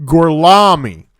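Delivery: slow, loud, stretched Gorlami; drawn-out fake Italian. Repeating the fake name for the German officer, slow and loud. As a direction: drag out "Gorlami" in a thick Tennessee-Italian. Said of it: "Can you say it again?".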